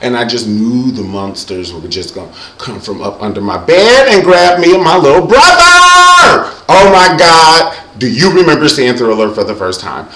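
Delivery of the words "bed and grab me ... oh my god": high, loud, drawn-out horror; mock-terrified shout. Recalling monsters under the bed, mock-terrified shout. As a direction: wail it like a spooked kid, hammed up. Reliving childhood monster terror.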